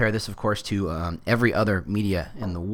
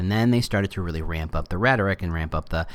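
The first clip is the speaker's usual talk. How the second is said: ordinary talk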